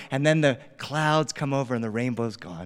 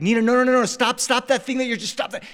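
high, frantic parental telling-off; exasperated scolding; 9 semitones higher, 4 dB louder than usual. Retold or imitated. Dad to daughter fighting in the back seat, high flustered scolding. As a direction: imitate a dad losing control of the back seat.

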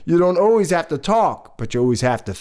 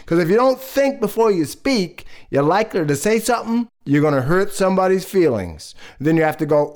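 usual speaking voice

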